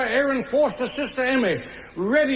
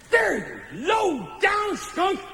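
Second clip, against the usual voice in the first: drawn-out, higher melodramatic scorn; relished contempt; 5 semitones higher, slower than usual. Acted. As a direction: stretch each insult with theatrical disgust. Narrator reviles the stage villain.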